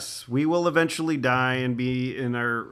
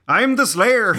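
put-on loud, higher Irish-accented slayer; 8 semitones higher, 8 dB louder, slower than usual. Spike's actor plays the slayer in a hammed-up loud Irish accent. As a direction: belt it in a mock Irish brogue. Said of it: "I've gone to Irish".

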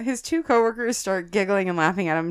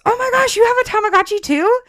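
high, loud squeal of delight; excited guard; 8 dB louder than usual. Retold or imitated. Young guard thrilled to find another Tamagotchi, high squeal. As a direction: gush like a kid on Christmas morning. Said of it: "He was so excited to show me".

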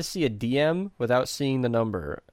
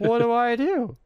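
panicked whine; 11 semitones higher, 4 dB louder than usual. Retold or imitated. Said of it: "I was like".

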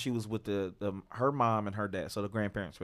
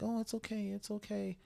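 mock soothing mom; 10 semitones higher, 8 dB quieter than usual. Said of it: "was like".

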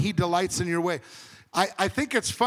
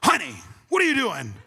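higher-pitched imitated scolding; retold mock yell; 4 semitones higher than usual. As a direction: imitate a wife's startled telling-off. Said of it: "that's when Tiffany yells at me".

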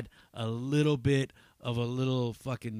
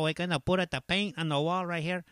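put-on Mexican landscaper accent; 7 semitones higher, 3 dB louder than usual. Comedian imitating a Mexican landscaper outside Home Depot, thick Mexican accent. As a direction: put on a higher-voiced ranchero Mexican accent. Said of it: "hanging out in front of Home Depot type of Mexican".

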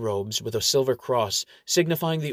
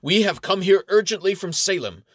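raised, louder official proclamation; authoritative; 6 semitones higher, 5 dB louder than usual. A narrator's reading. Phony paladin addresses the bank clerk, authoritative. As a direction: put on your most commanding voice. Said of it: "puts on his most authoritative voice".

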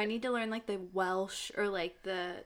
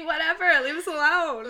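loud, higher, playful brush-off; mock defensive retort; 6 semitones higher, 8 dB louder than usual. Woman answers her fiance's teasing, mock defensive and loud. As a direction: fend off teasing, loudly and in fun.

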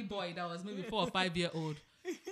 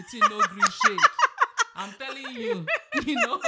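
laughing; 13 dB louder than usual. Real, not acted. Pidgin joke, laughing.

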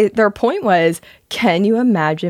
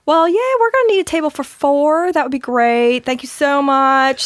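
put-on Southern accent; 8 semitones higher than usual. Phoning restaurants for a reservation, retold in a Southern accent. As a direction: put on a sweet, much higher Southern accent. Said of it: "I love a Southern accent".

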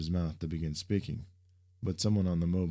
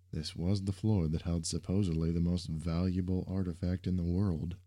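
ordinary delivery, nothing marked

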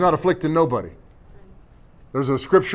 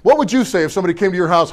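normal talking voice

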